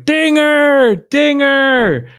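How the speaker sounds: high, loud drawn-out ballpark yell; imitated fan shout. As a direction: yell it like a fan calling the mascot. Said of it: "you hear the fan there shouting".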